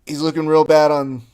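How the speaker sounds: loud mock coaching call from the sideline; imitated yelling out. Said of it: "you really shouldn't be yelling out".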